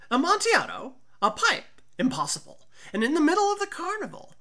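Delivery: loud, high, eager voice of the tipsy wine lover; excited drunk Fortunato. Acted. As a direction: blurt it out, greedy for the wine.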